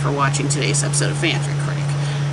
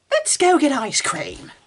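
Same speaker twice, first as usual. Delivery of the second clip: high, slow, sing-song mockery; high mock chirp; 8 semitones higher, slower than usual. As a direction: chirp it like a clueless parent. Mocking the story's solution.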